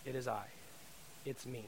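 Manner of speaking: Jesus calming the disciples, soft and reassuring; gentle reassurance. Retold or imitated. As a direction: reassure frightened disciples softly. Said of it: "He says".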